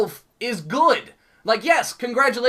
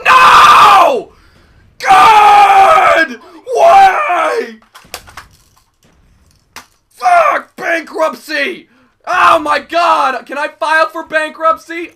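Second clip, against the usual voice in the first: loud high despairing cries; anguished yelling; 4 semitones higher, 12 dB louder, much slower than usual. Real, not acted. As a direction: wail at losing it all. Despair at losing.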